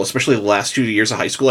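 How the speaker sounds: normal talking voice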